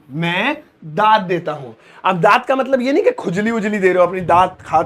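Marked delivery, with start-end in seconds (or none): 0.1-1.7 slow, careful model line; दाद said slowly and clearly; slow repeat. Shown as a demonstration. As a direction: say it slowly for them.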